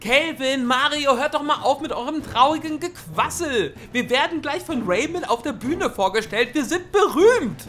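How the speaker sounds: high, loud, giddy chatter; excited. Acted. Pepe hyped before going on stage, excited.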